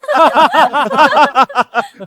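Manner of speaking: long, high burst of laughter; mocking laugh. Schemer hearing of rival's mango planting, mocking laugh.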